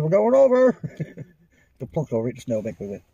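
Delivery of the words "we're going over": high, loud warning shout; alarmed shout. Retold or imitated. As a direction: yell out as you tip over. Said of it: "He said".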